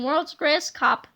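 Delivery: plain reading voice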